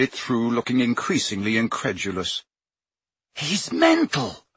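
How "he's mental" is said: high, slow outraged snap; furious. Acted. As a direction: spit it out in disbelief. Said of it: "he said furiously".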